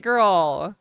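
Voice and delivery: ordinary talk, nothing marked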